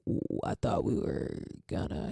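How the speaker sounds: breathy unvoiced letdown; whispered disappointment.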